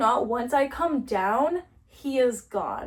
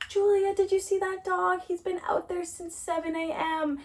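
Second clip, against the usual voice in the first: higher chatty neighbor impression; high-pitched neighbor voice; 7 semitones higher, 3 dB quieter than usual.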